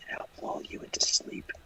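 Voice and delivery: slow, soft whisper into the mic; ASMR whisper. Retold or imitated. Joking about ASMR YouTube videos, slow smooth whisper. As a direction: imitate an ASMR voice, whispered slowly. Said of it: "talk really calmly and smoothly into a microphone".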